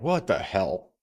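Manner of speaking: ordinary delivery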